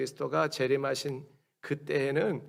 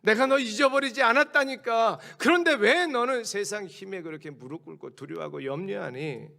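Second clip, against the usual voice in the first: higher, insistent exasperated God; God insisting; 7 semitones higher, 4 dB louder than usual. Acted. God insisting to fearful people, lightly voiced. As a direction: press it home like an exasperated father. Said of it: "하나님은 말씀으로 설명하잖아요".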